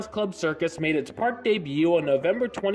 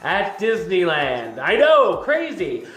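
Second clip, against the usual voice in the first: loud, lively show host; show host intro; 6 dB louder than usual. Acted. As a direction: hype up the episode intro. Excited podcast host intro.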